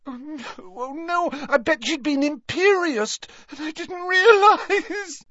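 high, distraught voice building to a wail; tearful sobbing. A narrator's reading. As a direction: explain through rising sobs, upset. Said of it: "Leanne shook with renewed sobs".

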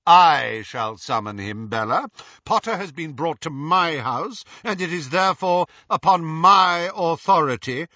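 loud, rising assertion of rank; indignant. A narrator's reading. Lucius quarrels over who summons.

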